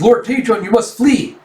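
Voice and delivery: acted frightened retainer; urgent warning. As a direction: urge your lord to run. Retainer urges lord to flee.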